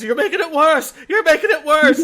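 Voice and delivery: high, loud, laughing cartoon shriek; traumatized Stewie. Quoting the Family Guy scene to the guest, high laughing shriek. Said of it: "Stewie was just traumatized".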